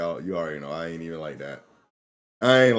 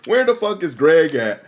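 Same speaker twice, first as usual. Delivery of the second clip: quoting her; 9 semitones higher, 10 dB louder than usual. Retold or imitated. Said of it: "she was like".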